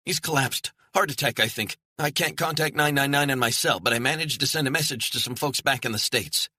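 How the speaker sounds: scared assistant briefing the hiker; frightened explaining. Acted. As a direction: rush the facts out in fear. Frightened woman explains the collapse.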